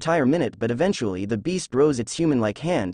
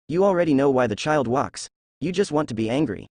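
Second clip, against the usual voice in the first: plain reading voice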